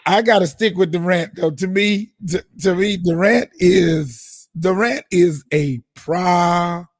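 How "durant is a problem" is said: "problem" stretched out syllable by syllable; slow right down and drag out "problem" for weight; emphatic verdict. Real, not acted. Emphatic verdict in sports debate.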